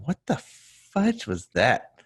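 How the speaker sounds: slow, high-pitched bafflement; mock disbelief. Actor walking out of a bad audition, mock disbelief. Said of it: "you just walked out of there going".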